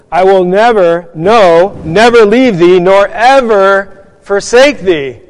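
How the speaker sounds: preacher stressing the promise, never, no, never; emphatic quote. Shown as a demonstration. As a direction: build the promise, hammering each never and the no, then slow on nor ever forsake thee. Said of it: "the expression goes like this".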